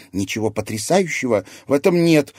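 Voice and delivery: loud, hard rebuttal; harsh. A narrator's reading. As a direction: cut the enthusiasm down firmly. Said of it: "оправившись жёстко".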